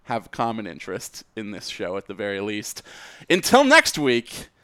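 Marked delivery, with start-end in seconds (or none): 3.3-4.5 announcer sign-off. Acted. Host's sign-off line.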